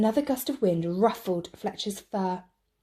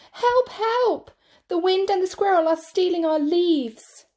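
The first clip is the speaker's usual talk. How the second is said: much higher, louder frantic call; crying out for help; 10 semitones higher, 5 dB louder than usual. Acted. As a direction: cub shouting across the forest. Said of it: "cried Fletcher".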